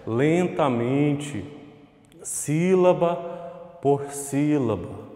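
drawn-out "lentamente, sílaba por sílaba"; slowly. Shown as a demonstration. Teacher showing pupils how to say a word, slowly. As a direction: say it slowly, pausing between phrases. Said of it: "Vai falando ela lentamente".

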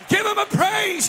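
loud call. Real, not acted. Pastor urging the church to praise, high loud call.

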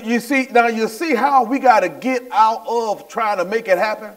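ordinary talk

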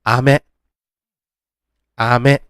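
อาเมะ begun low on อา; low-start pitch accent. Shown as a demonstration. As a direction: keep อา low, lift เมะ slightly. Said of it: "กรณีนี้ลูกอมจะเป็นเสียงต่ำนะ". (อาเมะ เสียงต่ำที่อา)

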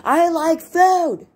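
high, loud, drawn-out yell; screaming exclamation. Shown as a demonstration. Writing teacher demonstrating exclamation points, full-on scream. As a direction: scream it right in someone's face, stretching the words. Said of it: "screaming it in someone's face".

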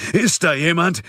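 high, loud, desperate call; frantic calling out. A narrator's reading. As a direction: shout urgently for anyone to answer. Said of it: "schrie hektisch". (verzweifelt und hektisch rufen)

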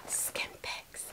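1 gasped whisper. Real, not acted. Gasps at package.

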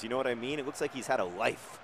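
ordinary delivery

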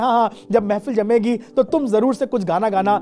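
usual speaking voice

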